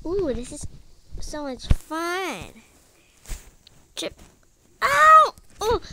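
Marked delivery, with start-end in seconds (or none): 4.8-5.3 sharp high yelp of pain. Pretend. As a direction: yelp as if suddenly hurt. Game character trips and falls.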